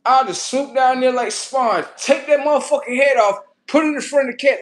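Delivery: loud, high, hammed-up revenge rant; worked-up threat. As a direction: boast loudly of imagined revenge. Imagined revenge on the man.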